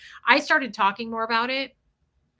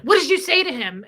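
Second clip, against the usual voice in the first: high, loud alarmed question; imitated paranoid demand; 6 semitones higher, 6 dB louder than usual. Teller imitates Jeff questioning what she said, paranoid and loud. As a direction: mimic his panicked interrogation. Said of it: "He was so paranoid".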